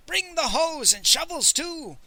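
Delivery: high, eager howl of an order; howled command. A narrator's reading. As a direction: call it out high, drawing out the end. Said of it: "howled Miser Shen".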